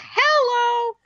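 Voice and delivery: squeaky high hello; a quick high-pitched hello; really high pitch. Shown as a demonstration. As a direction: jump the voice way up for the demo. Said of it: "say it like a really high pitch".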